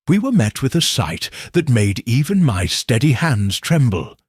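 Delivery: ordinary delivery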